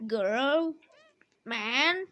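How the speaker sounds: normal talking voice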